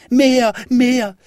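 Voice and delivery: put-on high, insistent child's yelling, loudest at the start; high-pitched, bossy clamour; demanding shout. A narrator's reading. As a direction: cry out orders like a spoilt little boy. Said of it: "schrie Häwelmann".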